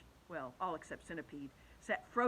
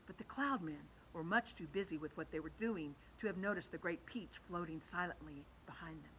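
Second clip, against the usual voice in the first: plain reading voice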